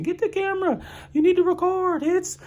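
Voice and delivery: frantic reporter impression. Podcaster imitating Jack Ryder calling his cameraman, high frantic shout. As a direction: squeal it urgently like a panicked reporter.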